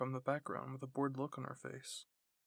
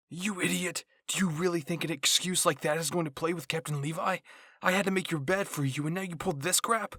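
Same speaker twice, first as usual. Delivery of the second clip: angry scolding; 4 semitones higher, 9 dB louder than usual. Acted. Squad members bicker over chores.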